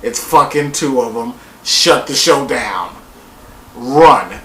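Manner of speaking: low, horrified mutter; mock dread. Himself seeing two of them at the door, low mock dread. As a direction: sound spooked and ready to bolt. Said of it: "I was gonna be like".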